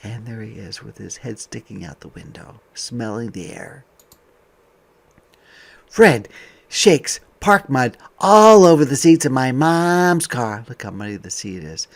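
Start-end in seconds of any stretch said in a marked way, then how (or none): 5.9-10.7 bright, lively picture-book voice; reading to children. Acted. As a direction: read it out to a class of kids.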